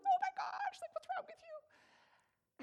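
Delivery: very high squeaky panicked reaction; mock alarm. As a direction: start loud and high, then stretch the question out. Acts own alarm at crying friend.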